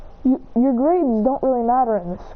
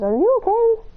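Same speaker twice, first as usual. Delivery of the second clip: put-on concerned boy voice; 12 semitones higher than usual. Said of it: "He says".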